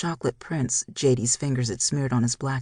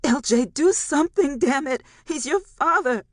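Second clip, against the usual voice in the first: very high, louder frantic shout; 11 semitones higher, 4 dB louder than usual. A narrator's reading. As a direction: yell at her frozen son. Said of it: "she shouted at her unmoving son".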